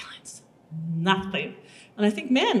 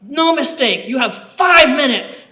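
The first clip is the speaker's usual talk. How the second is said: louder, higher firm command; stern guard; 5 semitones higher, 8 dB louder than usual. Retold or imitated. Guard refusing to listen, telling the recruit the time left. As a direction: clip the order short. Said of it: "bruiser of a woman".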